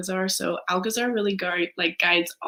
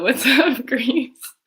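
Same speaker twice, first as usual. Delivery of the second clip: high, loud, laughing hello; laughing shout-out; 7 semitones higher, 6 dB louder, much slower than usual. Real, not acted. Host greets the Greek viewer, laughing and high.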